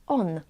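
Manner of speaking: "on" ending in n, tongue-tip behind the upper teeth. Shown as a demonstration. Teacher shows ą before D and T, on with n. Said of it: "it is pronounced as".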